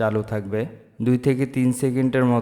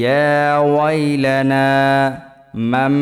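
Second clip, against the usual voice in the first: Quranic recitation; 3 semitones higher, 5 dB louder, much slower than usual. Shown as a demonstration. Teacher reciting the verses in slow melodic tilawat. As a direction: intone the verses slowly like a qari.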